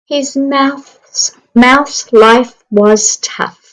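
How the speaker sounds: lively storybook narration for a class; reading to children. Acted. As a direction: read it like story time on the carpet. Reading picture book aloud.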